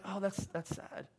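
soft, slow, hollow pity; a passing sigh of pity that goes nowhere; empty sympathy. Shown as a demonstration. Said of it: "I don't want an emotional".

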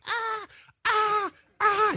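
high wailing newborn cry; mock baby crying "ah, ah"; imitated baby cry. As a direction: wail like a screaming newborn. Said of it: "she's just screaming and screaming".

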